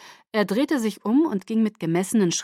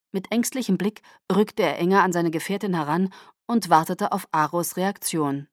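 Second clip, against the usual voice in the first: plain reading voice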